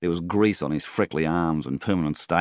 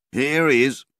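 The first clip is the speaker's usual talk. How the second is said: higher, louder yell of greeting; guttural yell; 10 semitones higher, 7 dB louder than usual. A narrator's reading. Flynn greeting the arriving men, loud yell. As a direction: call it out across the kitchen. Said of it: "yelled a guttural voice".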